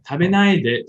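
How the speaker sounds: tabenai de said as a feminine plea; feminine request. Shown as a demonstration. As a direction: plead with him not to. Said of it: "the feminine way".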